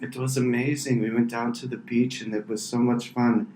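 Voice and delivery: quiet, flat-toned Swede voice; calm Swedish person, no highs; deadpan Swede. Shown as a demonstration. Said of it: "my impression of a Swedish person".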